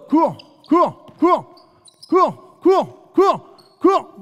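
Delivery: loud, high barked "cours" over and over; shouted command. Real, not acted. Coach shouting at player to run. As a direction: yell at them to run.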